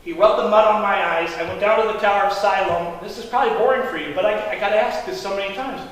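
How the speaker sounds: normal talking voice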